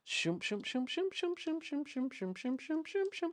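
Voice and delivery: soft, high rhythmic shooms; imitated whooshing noise. Real, not acted. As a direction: repeat little whooshes in a quick chant. Makes noises while working.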